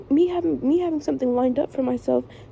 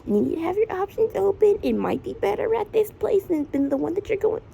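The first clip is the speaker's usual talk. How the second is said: mom lecturing; 7 semitones higher than usual. Retold or imitated. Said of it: "sitting there lecturing me".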